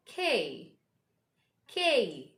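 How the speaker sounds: K enunciated firmly with a falling tune; careful letter name. Shown as a demonstration. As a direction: say K clearly for learners. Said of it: "the pronunciation of this is".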